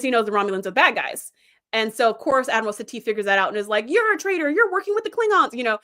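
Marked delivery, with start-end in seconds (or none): 3.9-5.5 high-pitched imitation of an accuser; hammed-up, indignant finger-pointing voice; retold denunciation, pitched well up; mock accusation.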